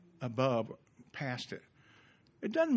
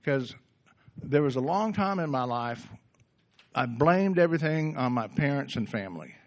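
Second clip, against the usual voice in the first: ordinary delivery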